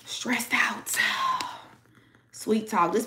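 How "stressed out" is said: breathy whisper, soft; whispered aside. Real, not acted. Reader whispering that the person is stressed out.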